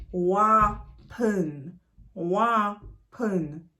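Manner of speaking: "weapon" drawn out as two separate beats; split Patois phrase. Shown as a demonstration. Teacher drilling learners on two words, "weapon" split slowly. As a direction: say "weapon" slowly as wah, pause, pun. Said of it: "two words".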